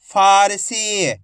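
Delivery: फारसी very slowly sounded out; drawn-out long ई. Shown as a demonstration. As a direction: stretch फा and सी in फारसी. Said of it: "खींच के पढ़ा जाता है".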